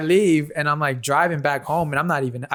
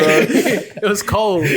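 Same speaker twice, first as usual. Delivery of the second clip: a little louder and higher, laughing while he tells it; 5 semitones higher, 4 dB louder, much slower than usual. Real, not acted. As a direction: laugh the words out slowly.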